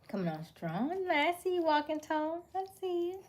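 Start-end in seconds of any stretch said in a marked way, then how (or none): 1.1-3.2 1 playful high sing-song. Real, not acted. Teasing greeting to viewer.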